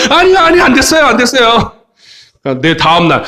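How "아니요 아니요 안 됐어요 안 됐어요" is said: high-pitched, hammed-up protest; squealed comic pleading; mock student panic. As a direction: play students frantically begging off. Acted students panicking before test.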